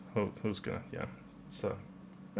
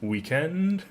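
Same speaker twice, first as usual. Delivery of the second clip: WEEKEND with a higher second syllable; British stress on WEEKEND; 6 semitones higher than usual. Shown as a demonstration. Repeating the weird-sounding British word, stress on weekEND. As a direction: lift the END of "weekend". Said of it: "British people say".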